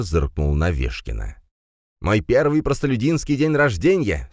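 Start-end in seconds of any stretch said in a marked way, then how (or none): 2.0-4.3 high-pitched gleeful exclamation; beaming. A narrator's reading. Aristocrat at his first common birthday, beaming. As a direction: say it with a huge grin. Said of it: "с улыбкой до ушей произнёс аристократ".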